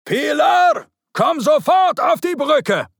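high, loud shouted order from a father; demanding shout. A narrator's reading. Father summons his daughter to the bridge, demanding shout. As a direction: shout it as an impatient command. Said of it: "deutlich fordernden Worten ihres Vaters".

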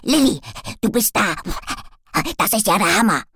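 loud, overjoyed little dog; bouncing with joy, loud; excited dog. A narrator's reading.

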